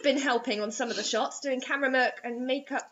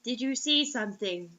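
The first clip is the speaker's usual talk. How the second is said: softer, flat retake of the question; 2 semitones lower, 6 dB quieter than usual. Shown as a demonstration. Correcting the friend's line, soft and flat. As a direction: redo it soft and flat like the text says. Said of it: "that should have been".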